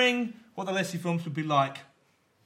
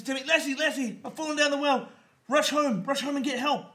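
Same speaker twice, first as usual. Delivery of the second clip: put-on high, urgent child's cry for help; panicked boy; 9 semitones higher than usual. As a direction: beg the dog to rush home. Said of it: "Timmy falls down the well and says".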